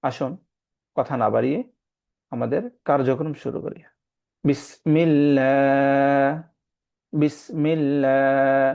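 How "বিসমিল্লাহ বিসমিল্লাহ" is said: slow, drawn-out tajweed recitation; Quran recitation. Shown as a demonstration. Teacher demonstrating recitation to students, slow chant. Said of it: "এটা হচ্ছে আল্লাহ শব্দ".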